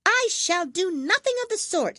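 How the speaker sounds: high, loud huffy squeak; indignant tiny mouse; offended mouse. A narrator's reading. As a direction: bristle with wounded pride.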